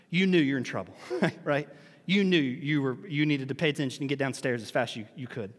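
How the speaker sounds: ordinary talk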